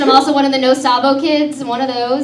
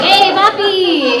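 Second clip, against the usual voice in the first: flirty papi; 6 semitones higher, 3 dB louder, much slower than usual. Retold or imitated. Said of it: "I was like".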